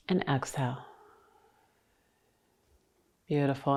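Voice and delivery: soft, spacious breathing cues; guided breathing. Real, not acted. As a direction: count them gently through the breath, very softly.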